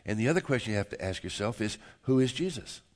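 ordinary talk, nothing marked

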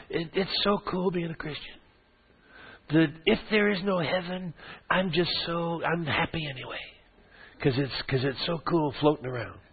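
floaty super-spiritual voice. Retold or imitated. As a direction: play the airy churchgoer who floats up to you, gently mocked. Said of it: "they're kind of talking in a little different voice".

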